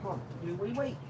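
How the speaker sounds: high, sing-song voice for the dog; doggy talk. Acted. Owner coaxing his dog to find the ball, high sing-song. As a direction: coax the dog like a pet owner.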